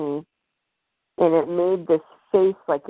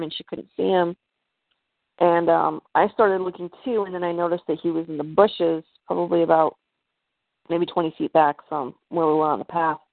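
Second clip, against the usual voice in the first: usual speaking voice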